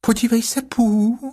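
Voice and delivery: very high, small piglet voice; high-pitched little animal. Acted. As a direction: squeak it out like a tiny piglet. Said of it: "řeklo najednou prasátko".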